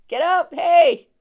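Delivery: loud, high, slow yell to wake him; frantic shout. Retold or imitated. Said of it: "woke him up".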